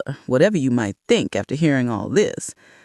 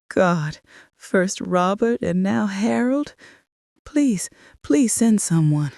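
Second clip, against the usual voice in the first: higher, staged distress; calling emergency services, faking tearful panic; calibrated grief; 5 semitones higher than usual. A narrator's reading. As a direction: perform grief for the dispatcher. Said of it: "my voice breaking with perfectly calibrated grief".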